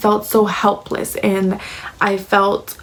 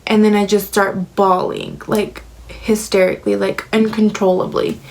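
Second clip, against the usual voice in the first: ordinary talk, nothing marked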